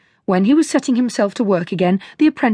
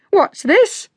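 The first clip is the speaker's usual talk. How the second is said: much higher, sharp outraged cry; indignant cry; 11 semitones higher than usual. A narrator's reading. Shoemaker catches the carpenters, outraged cry. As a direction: bark it at the carpenters. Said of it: "cried he".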